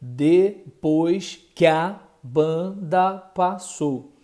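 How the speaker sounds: "Depois que a banda passou" drawn out slowly; slow demonstration. Shown as a demonstration. Teacher asks students to observe, reading the verse slowly. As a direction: read it slowly so each sound is heard. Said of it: "observe bem".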